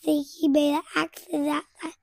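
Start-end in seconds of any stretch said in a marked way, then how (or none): none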